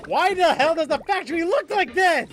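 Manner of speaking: loud, high, incredulous rant; exasperated outburst. Real, not acted. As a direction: demand to know why, raising the voice. Baffled at the game's shop design.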